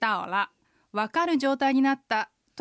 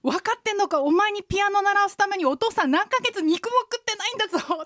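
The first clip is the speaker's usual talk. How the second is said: high, loud scolding father; father scolding his daughter over piano lessons; angry father; 9 semitones higher, 3 dB louder than usual. Retold or imitated. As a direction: scold her like an exasperated dad. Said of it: "お父さん怒ってこう言うんですよ".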